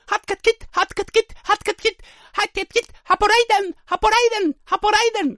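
clown alarm cries. Pretend. Clown in despair crying out, high squeaky yelps. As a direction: chirp the nonsense cries like a cartoon clown. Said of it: "Estos son llamadas de alarma".

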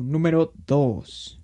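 slow, drawn-out imitation of the YouTuber; Dross voice. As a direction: stretch "número dos" like Dross. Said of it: "Como Dross".

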